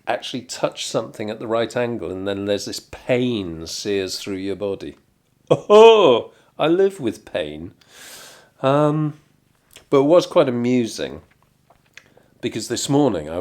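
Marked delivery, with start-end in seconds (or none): none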